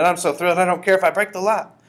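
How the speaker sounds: high, loud, giddy outburst; carried away, at full volume; thrilled shouting. A narrator's reading. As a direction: shout with reckless delight. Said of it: "she shouted".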